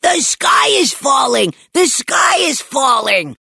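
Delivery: high-pitched shouting in alarm; excited child's shout; panicked yell. A narrator's reading. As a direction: yell it like a kid sounding the alarm. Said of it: "waved a lightsaber and yelled".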